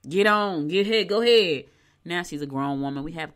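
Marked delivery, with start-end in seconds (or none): 0.1-1.7 loud mock "go on, get out" to a teenage daughter; fed-up mother shooing off her teen, mock loud; mock shooing off, louder. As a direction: push her out the door, louder. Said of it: "she was getting on my nerve".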